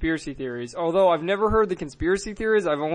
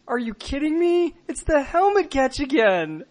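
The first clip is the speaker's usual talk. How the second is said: high-pitched incredulous exclamation; retold disbelief; 9 semitones higher than usual. Fan to the room at the helmet catch, incredulous. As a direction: cry out in stunned disbelief, voice jumping up. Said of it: "looked at everyone in the room and I said".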